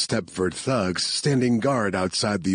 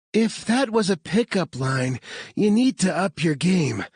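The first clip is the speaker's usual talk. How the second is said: out of breath; 11 semitones higher than usual. A narrator's reading. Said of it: "She sounded out of breath".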